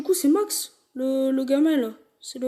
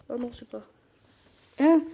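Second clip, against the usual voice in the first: ordinary talk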